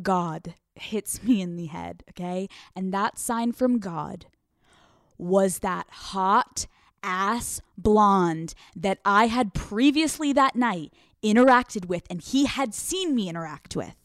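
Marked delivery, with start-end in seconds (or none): none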